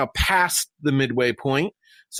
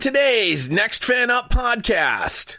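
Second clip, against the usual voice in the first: announcer voice; 13 semitones higher than usual. Acted. Podcast teaser intro with music.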